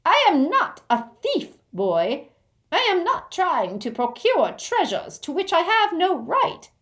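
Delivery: indignant goblin, very high and loud; high, loud outrage; angry goblin. Acted. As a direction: protest in offended goblin fury. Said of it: "Griphook flushed angrily".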